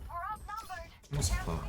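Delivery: put-on high, slow, soft childish whine; whiny child voice. Streamer whining for sunflower seeds like a small child, high and slow. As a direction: whine it like a little kid.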